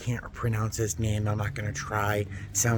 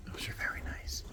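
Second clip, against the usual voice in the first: hushed, breathy "Those are very nice"; whispered admiration; 12 dB quieter than usual. Real, not acted. Shopper whispering over pottery she admires. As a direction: whisper it so nobody in the store hears.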